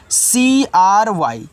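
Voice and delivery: slow letter-by-letter spelling C-R-Y of सीआरवाई; teacher spelling for the class; spelled out slowly. Shown as a demonstration. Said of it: "इसको पढ़ते हैं क्राई".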